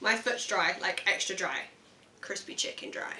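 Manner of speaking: low, soft, fading to a near whisper; deadpan quote. Retold or imitated. Friend quoting her favourite line, deadpan and hushed.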